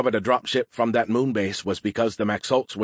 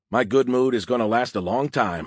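plain reading voice

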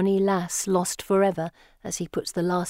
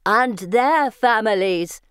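slow, louder spectral voice; ghostly voice; 3 semitones higher, 5 dB louder, slower than usual.

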